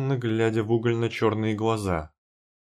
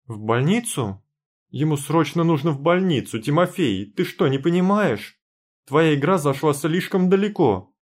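high, loud desperate cry on the verge of tears; shouting, near tears; 6 semitones higher, 4 dB louder than usual. A narrator's reading. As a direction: yell at him, almost crying. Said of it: "вскричала я, чуть не плача".

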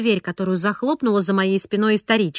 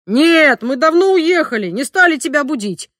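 excited explaining; 4 semitones higher, 6 dB louder than usual. A narrator's reading. Father excited on phone.

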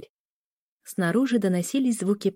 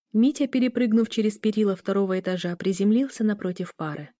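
ordinary delivery

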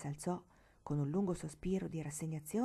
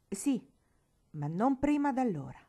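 raised, emphatic voice; stern concession; 5 semitones higher, 5 dB louder than usual. A narrator's reading. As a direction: grant it firmly, allowing no argument. Uncle grudgingly lays down condition. (severo e perentorio)

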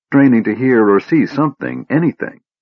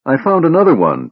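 higher-pitched, mildly tearful confession; blubbering; 6 semitones higher than usual. A narrator's reading. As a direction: read it as a mild blubber. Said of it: "Tass blubbered".

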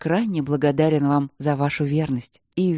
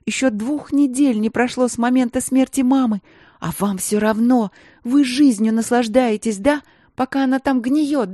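high, loud furious accusations; raised, bitter reproach; furious tirade; 6 semitones higher, 4 dB louder than usual. A narrator's reading. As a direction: lash out at her sisters. Grieving sister rages at sisters.

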